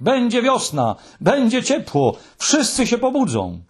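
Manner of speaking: high-pitched jubilant cries; overjoyed, sustained high exclaiming; excited shouting. A narrator's reading. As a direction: proclaim good news at a high pitch. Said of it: "krzyczał Muminek".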